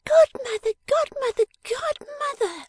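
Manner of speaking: very high, exasperated little-dressmaker voice; impatient high-pitched scolding; irritable cry. Acted. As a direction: call it high and loud, stretching the last one. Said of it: "cried Miss Wren irritably".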